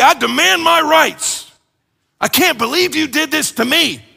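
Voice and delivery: high, loud and slow, demanding his rights; hammed-up wounded protest; mock indignant outcry. Mock self-pity on the cross.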